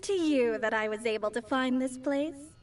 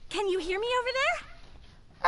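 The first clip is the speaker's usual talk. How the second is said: across the street; 9 semitones higher than usual. Acted. Calling to someone far away.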